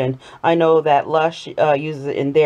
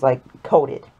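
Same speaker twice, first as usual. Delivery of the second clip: ordinary talk, nothing marked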